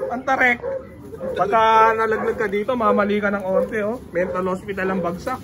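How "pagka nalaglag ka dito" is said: normal talking voice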